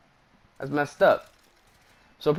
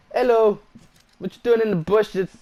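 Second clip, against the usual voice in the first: mock cheery greeting; 8 semitones higher, 7 dB louder than usual. Taunting enemy hiding in bushes.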